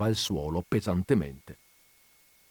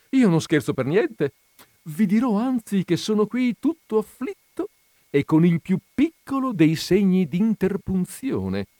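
high-pitched, louder, playful insistence; jovial joker; 11 semitones higher, 4 dB louder than usual. A narrator's reading. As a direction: set up the pun with relish. Joker Antonio answers the offended widow. (burlone, tono alto e teatrale)

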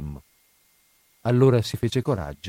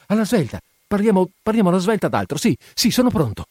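high, excited, stumbling exchange; flustered, happy lovers; 6 semitones higher, 4 dB louder than usual. A narrator's reading. Two new lovers begging to talk of something else, flustered and elated. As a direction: hurry to change the subject, overwhelmed with joy.

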